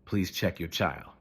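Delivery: ordinary delivery, nothing marked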